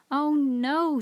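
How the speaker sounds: annoyed at the dog's chase; vexed. A narrator's reading. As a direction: George vexed that the dog chases the cat.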